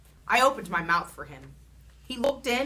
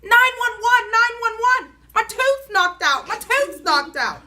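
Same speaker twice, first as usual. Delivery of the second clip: loud, high, slowed calls of nine one one; frantic yelling; 10 dB louder than usual. A narrator's reading. Girl at the open front door, frantic loud calls. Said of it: "I zoomed to the front door".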